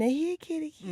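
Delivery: soft, sweet coaxing "Here, kitty, kitty"; gentle coaxing. Shown as a demonstration. Teaching women to leave slyly, gentle coaxing. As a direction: lure the cat in quietly. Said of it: "You being gentle".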